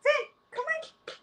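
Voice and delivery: very high, slow cooing; coaxing pet voice. Real, not acted. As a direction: sweetly plead with the cat. Coaxing cat to come.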